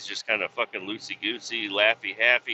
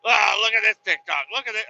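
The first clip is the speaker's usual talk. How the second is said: higher, louder excited yelling; mock excited call; 8 semitones higher, 5 dB louder than usual. Imitating the New York guy pushing TikToks on him, loud and high. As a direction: shout it like an overexcited kid with a phone. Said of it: "He's like".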